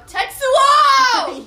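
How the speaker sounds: high, long-drawn shout; dramatic name call. Retold or imitated. Acting out the repeated name call.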